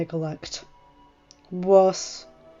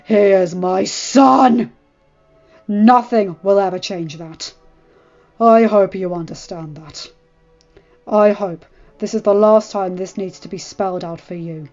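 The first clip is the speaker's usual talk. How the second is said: losing patience; 9 dB louder than usual. A narrator's reading. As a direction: lay down the law, out of patience. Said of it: "losing his patience".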